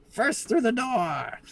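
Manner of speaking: very high, slow heroic squeak; kobold sorcerer. Acted. Tiny kobold sorcerer boldly leading the charge. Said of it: "the kobold sorcerer".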